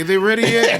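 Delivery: high-pitched, loud pestering question; mock impatient diners. Imitating diners waiting for the chef's dish, high impatient voice.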